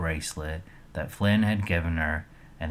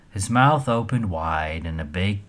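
usual speaking voice

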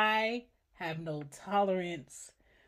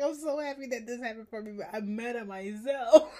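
laughing; 5 semitones higher, 8 dB louder, faster than usual. Retold or imitated. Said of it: "I'm like".

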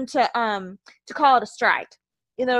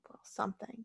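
whispered aside; 16 dB quieter than usual. Real, not acted. Muttered aside in rant.

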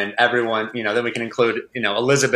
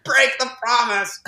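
high, slow mock shout at the character; a half-serious outburst at the page; yelling at the book; 12 semitones higher than usual. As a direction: cry out to her to just break it. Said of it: "and I was like".

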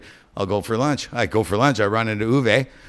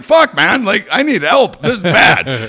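frantic plea; 9 semitones higher, 9 dB louder, slower than usual. Retold or imitated. Pleading with calm dispatcher.

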